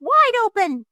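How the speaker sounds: wife yelling at the fox. A narrator's reading. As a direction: shout the order sharply. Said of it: "yelled his wife".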